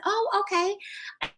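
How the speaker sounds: high, drawn-out acknowledgement; acted slow, lilting reply to a kid; encouraging interest. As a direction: stretch it out high, as to a child.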